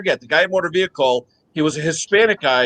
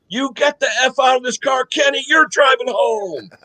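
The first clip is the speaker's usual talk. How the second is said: yelling teacher; 7 semitones higher than usual. Retold or imitated. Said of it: "He goes".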